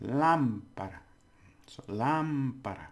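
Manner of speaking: "lámpara" with a long, strong "lám"; stress on first syllable. Shown as a demonstration. Teacher showing learners the word for lamp, stress on first syllable. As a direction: lean on "lám" in "lámpara", then let it fall. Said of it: "the stress is on there".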